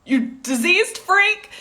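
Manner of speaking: mock disgusted jab. Bystanders reacting to a cough, mock disgusted and slow. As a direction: sneer it like a horrified bystander. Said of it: "everyone's like".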